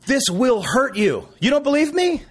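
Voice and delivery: exasperated dad. Retold or imitated. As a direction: spell it out, then throw up your hands.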